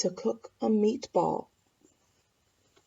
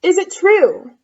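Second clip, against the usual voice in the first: put-on eager girl voice; 8 semitones higher, 10 dB louder than usual. Elizabeth flatters dragon.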